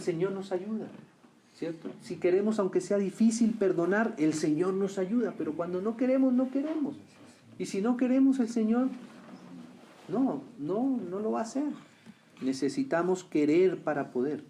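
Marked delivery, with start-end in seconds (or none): 5.4-11.7 rising preaching fervor. Real, not acted. Preaching builds in pitch.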